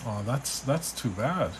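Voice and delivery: ordinary delivery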